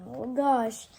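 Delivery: ordinary delivery, nothing marked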